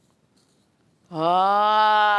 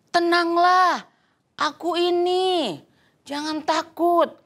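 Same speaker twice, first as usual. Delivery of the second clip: Jesus reassuring; 7 semitones higher than usual. Acted. Jesus calms frightened disciples.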